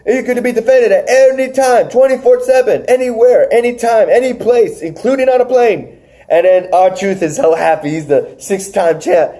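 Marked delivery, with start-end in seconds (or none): 0.1-6.0 high-pitched, emphatic list of rules; insistent referee. Retold or imitated. Referee explaining the title rules to him, high and insistent. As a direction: hammer each phrase, pitched up high. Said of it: "The referee's telling him".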